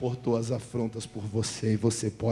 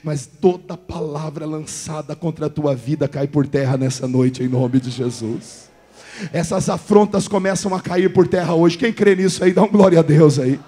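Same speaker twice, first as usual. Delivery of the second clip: loud, rising preacher's cry; fiery sermon climax; full-force preaching; 3 semitones higher, 15 dB louder than usual. Acted. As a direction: preach it out to the whole hall.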